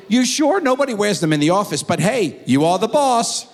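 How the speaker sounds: defer to the boss; lightly voiced stranger; high deferential texter. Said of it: "And they say".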